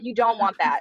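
ordinary talk, nothing marked

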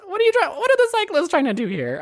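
high, incredulous question; a touch of mock exasperation; baffled. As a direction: ask it in disbelief. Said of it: "And I'm like".

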